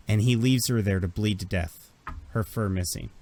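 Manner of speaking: ordinary talk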